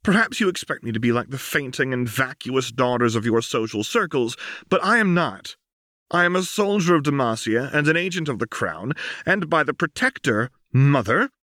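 put-on proud young soldier facing her mother; Lux, defiant daughter. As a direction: stand tall and refuse her mother.

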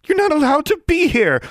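shrill, loud, frightened witch; panicked cry. Acted. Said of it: "the witch cried out".

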